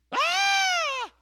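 sudden high shriek of alarm; a sharp, high scream like a panicked mom; wife screaming as their daughter runs into the street; mother's scream. Retold or imitated. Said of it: "Mama started screaming".

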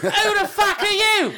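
very high, drawn-out Cockney squawk; Cockney accent. Acted. Alien confronting the first humans it meets, shrill Cockney. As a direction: screech it slowly as a Cockney alien. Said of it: "came down and had a Cockney accent".

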